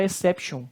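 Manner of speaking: exception ending in an English -shun; wrong English-style pronunciation. Shown as a demonstration. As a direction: mispronounce exception as an English word. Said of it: "porque não é inglês".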